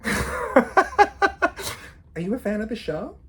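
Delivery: high, slow teasing question through a laugh; teasing mock question. Student imagines quizzing the lecturer who looked at her, teasing and amused. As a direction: ask it cheekily, drawn out and amused. Jokes she'd ask the lecturer.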